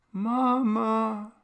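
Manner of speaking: high, drawn-out eerie little voice; a ghost girl calling from underwater; ghost child. A narrator's reading. Ghost of the little sister calling to mother, high eerie. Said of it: "said the little girl".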